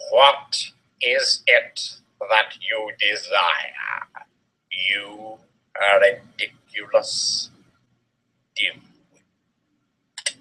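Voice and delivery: low, slow, sneering drawl; cold Snape voice. A narrator's reading. Snape sneering at a student, low and cold. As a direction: look down on them, slow and low. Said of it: "Snape demeaned angrily in his cold voice".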